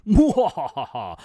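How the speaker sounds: slow hammy evil cackle; evil villain laugh. Acted.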